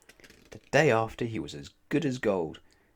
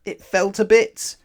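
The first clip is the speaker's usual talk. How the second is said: dramatic; 10 semitones higher, 9 dB louder than usual. A narrator's reading. Chair collapses, dramatic reveal.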